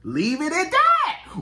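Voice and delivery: high, loud, slow holler; drawn-out shout. Pretend. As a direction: belt it out, stretching each word. Performed exclamation of the catchphrase.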